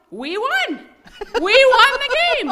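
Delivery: a high, loud, laughing victory yell; excited yell. Acted. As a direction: yell in delight at winning. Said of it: "And then Tom yelled".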